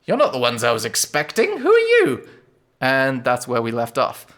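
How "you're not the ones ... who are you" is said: high cracked crone voice; old woman's voice. Acted. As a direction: rise sharply into a shrill old woman on who are you. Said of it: "And she said".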